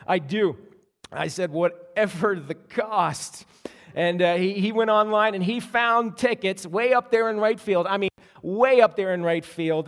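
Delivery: ordinary talk, nothing marked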